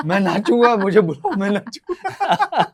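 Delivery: high, loud, laughing mock eagerness; mock-eager I'll dance voice; eager dancer mimic. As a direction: imitate him begging to dance. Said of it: "कहते हैं".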